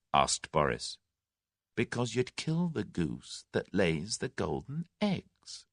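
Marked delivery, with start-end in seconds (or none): 1.8-5.7 softer and slow, calm menace; a quiet, slow warning from a captive; quietly. A narrator's reading. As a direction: lay it out quietly and slowly. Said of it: "replied Tommy quietly".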